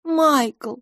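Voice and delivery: high voice with falling tone on Майкл; gently name him, pitched high; soft. A narrator's reading. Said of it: "мягко сказала мисс Лафос". (мягко, высоко, с нисходящей интонацией)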